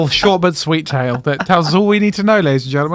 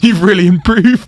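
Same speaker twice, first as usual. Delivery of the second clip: louder, higher sing-song praise as mum; mock proud mum; 6 semitones higher, 7 dB louder than usual. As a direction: gush like a proud mother, hammed up. Mocking mum's praise.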